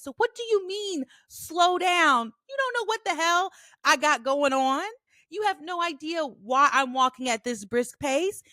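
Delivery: retold indignant rant, pitched high; pissed off. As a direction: act out telling off a stranger, outraged. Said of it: "Now I'm pissed".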